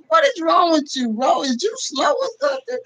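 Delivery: high laughing roast, half shouted; laughing mock. YouTuber laughing at a Lions fan, mocking. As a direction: laugh at him while roasting. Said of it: "Bro, I'm laughing like".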